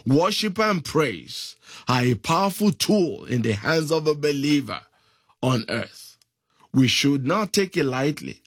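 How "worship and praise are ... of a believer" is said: ordinary talk, nothing marked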